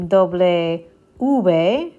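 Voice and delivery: "doble V" said slowly and clearly in Spanish, doh-bleh oo-beh; slow model pronunciation. Shown as a demonstration. Teacher modeling the letter name for students, slow and clear. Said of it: "You can also say".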